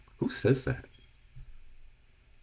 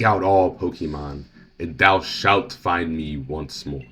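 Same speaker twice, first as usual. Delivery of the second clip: slow, grand, solemn reading; slow, lower, theatrical prophecy voice; dramatic; 3 semitones lower, slower than usual. Acted. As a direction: read it like an oracle speaking from beyond. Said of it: "That was a little dramatic".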